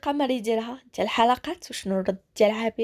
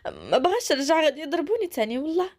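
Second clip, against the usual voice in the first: sniffling; 5 semitones higher than usual. Acted. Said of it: "كتنخسس".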